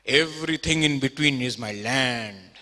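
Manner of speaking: slow and proud, with a laugh in it; drawn-out boast of a rustic Haryanvi farmer. Retold or imitated. Haryanvi farmer boasts to the Texan about his land, slow laughing pride. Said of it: "He said".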